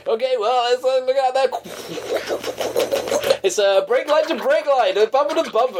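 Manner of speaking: a high, slowed-down mock DJ traffic update; American radio DJ. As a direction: play a cheesy American traffic reporter. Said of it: "I've gone American".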